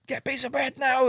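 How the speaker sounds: high-pitched excited order; frantic urging. Retold or imitated. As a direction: imitate a friend urgently demanding something, voice way up. Retelling friend's urgent order.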